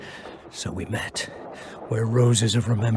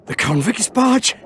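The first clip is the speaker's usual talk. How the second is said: higher and louder, dismayed disbelief; alarmed realisation; 13 semitones higher, 7 dB louder than usual. Acted. Wounded elf learning he is on the convict's barge, alarmed. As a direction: catch the horror of the word barge.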